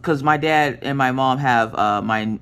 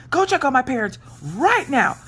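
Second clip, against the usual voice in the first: high, emphatic angry order; high, insistent demand at a sister; retold mad demand; 11 semitones higher than usual. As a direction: re-enact an angry command, pitched up. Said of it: "I was mad".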